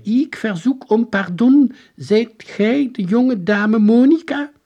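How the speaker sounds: high, loud, slow archaic soldier; ceremonious high-pitched proclamation; strange voice. A narrator's reading. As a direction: declaim slowly like a stiff old-time general. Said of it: "Een vreemde stem zei".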